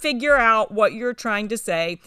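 drawn-out baffled whine; exasperated disciples. Retold or imitated. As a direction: plead like confused students at their wits' end. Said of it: "they're like".

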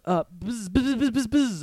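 high-pitched imitated buzzing; quick high mock bee buzzes; bee noises. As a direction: buzz like a swarm of bees. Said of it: "starts making bee noises".

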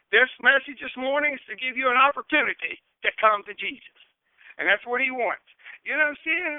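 loud, insistent pulpit appeal; urgent preaching. Real, not acted. Preacher urging listeners to come to Jesus, urgent.

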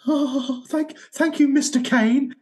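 high, stammered starstruck thanks; flustered fan. Retold or imitated. Fan meeting Michael Caine at a signing, high flustered stammer. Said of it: "and I went".

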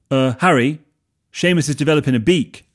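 high, loud worried voice; alarmed. Acted. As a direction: sound alarmed about the beak. Dean alarmed about the beak.

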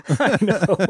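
high giggly laughing agreement. Real, not acted. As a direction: laugh through the words. Laughing at the lyric.

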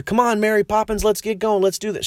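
high, eager coaxing; mock children begging her to join; pleading kids. Children urging Mary Poppins to join, high and eager. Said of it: "when they asked her".

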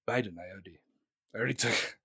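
low, quiet, halting reply; soft, low put-on Russian leader; Putin impression. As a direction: cut in quietly, low and hesitant. Acting Putin answering Biden.

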